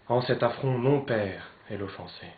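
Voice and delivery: loud, declaimed with weight on "mon père"; forceful theatrical lament; anguished outcry. Acted. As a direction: cry out the affront to your father. First outcry at the affront.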